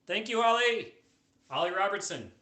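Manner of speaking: loud, high shout of thanks; called-out thanks. Real, not acted. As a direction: call it out big and high to a supporter. Calling out thanks to a viewer.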